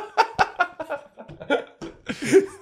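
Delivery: high-pitched laughing fit; long, high peal of laughter; laughing hard. Real, not acted. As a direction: crack up helplessly. Cracking up at the accent joke.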